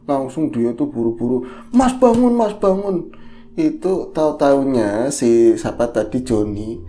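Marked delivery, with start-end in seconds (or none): 1.7-3.1 high, hurried call; urgent waking. Retold or imitated. Wife waking her husband because Nina is gone, urgent. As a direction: rouse him fast, he has to get up.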